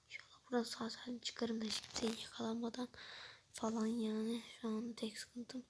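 conspiratorial whisper. Real, not acted. Vlogger whispering to viewers, hoping not to get caught. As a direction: hush it like a secret. Secret aside to camera.

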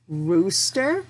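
usual speaking voice

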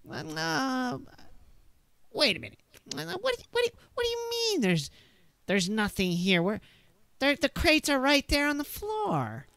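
high, hesitant, unsure voice; a confused smuggler squeaking in doubt; nervous NPC voice. Acted. Game master voicing the confused man to the player, high and unsure. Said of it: "he kind of like".

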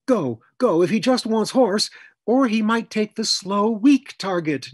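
ordinary delivery, nothing marked

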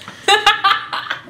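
high-pitched burst of giggles; high, quick giggle; giddy laugh. Real, not acted. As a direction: laugh at your own lovestruck confession. Laughs after confessing being in love.

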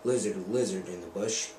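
plain reading voice

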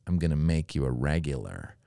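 low, hushed offer; quiet godfather granting a favour; mafia boss. Retold or imitated. Club owner promises comedian a spot, low mafia-boss murmur. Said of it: "it's like a mafia situation".

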